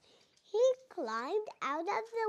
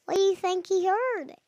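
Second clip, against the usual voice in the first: ordinary delivery, nothing marked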